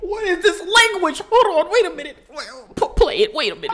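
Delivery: high, loud disbelieving call; stunned high outcry. Real, not acted. Watching the mother and daughter fight, high stunned outcry. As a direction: cry out, confused and amazed.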